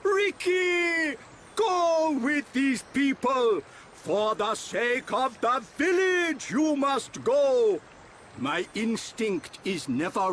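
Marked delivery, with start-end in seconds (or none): 0.0-7.8 raised, high chief's order; grand, insistent command. Acted. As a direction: send him off forcefully. Chief orders Ricky away.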